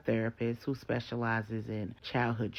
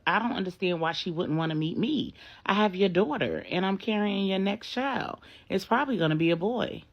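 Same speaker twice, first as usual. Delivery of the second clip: higher, louder hurt insistence; indignant protest; 7 semitones higher, 3 dB louder than usual. Acted. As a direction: plead your case, rising and loud.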